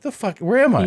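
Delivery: jolted, high-pitched confusion; suddenly high and alarmed, lost in a strange room; panicked waking. Retold or imitated. Waking abroad, panicked, asking where he is.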